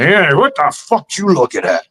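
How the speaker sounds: lightly voiced macho challenge; mock tough guy. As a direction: bark it like a shirtless bully. Muscled guy picking a fight.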